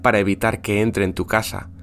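hushed campfire horror narration; spooky storytelling. Acted. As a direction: tell it like a scary bedtime tale. Tells Santa as a horror tale.

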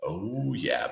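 low, soft mimicked tagline; imitating the clip's tagline. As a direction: imitate a deep commercial sign-off, lightly. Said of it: "it goes".

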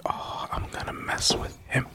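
soft, conspiratorial whisper; retold thought, whispered mischievously; scheming whisper. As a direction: whisper it like a prank being hatched. Scheming to himself in retelling.

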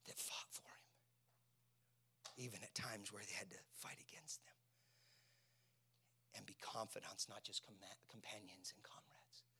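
low, whispery preaching; nearly whispered, weighty delivery; intimate hush. Real, not acted. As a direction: speak softly with long pauses.

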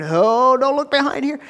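loud, high-pitched pretend alarm; mock secretive protest. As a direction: act out hiding something, high and exaggerated. God mockingly voiced hiding plan.

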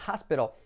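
"hospital" as HAS-pero; pronunciación correcta. Shown as a demonstration. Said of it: "la L como letra clave". ("hospital" como «jáspero»)

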